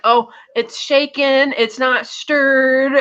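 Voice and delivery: very slow, high, drawn-out words; mock the way people talk to someone who can't understand; hammed-up patronizing over-enunciation; talking down slowly. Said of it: "as if he doesn't understand".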